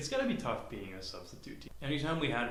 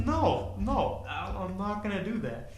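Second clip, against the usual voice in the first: high refusal; 7 semitones higher, 5 dB louder than usual. Retold or imitated. Refusing the pickles, acted.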